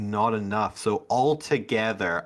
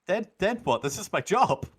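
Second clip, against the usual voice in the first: high-pitched protesting guard; in-character squeaky indignation; indignant guard; 9 semitones higher than usual. Acted. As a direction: argue back as a flustered little underling. Guard argues back in character.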